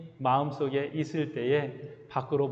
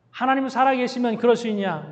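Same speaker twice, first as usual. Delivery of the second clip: mocking taunt; 7 semitones higher, 5 dB louder than usual. Goliath's taunt voiced again.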